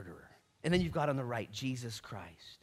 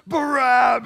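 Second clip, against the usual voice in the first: BARABBAS bellowed high and drawn out; long, loud, high crowd yell; crowd's shout; 13 semitones higher, 20 dB louder, slower than usual. Acted. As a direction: roar the name like a mob. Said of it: "no other way to say Barabbas".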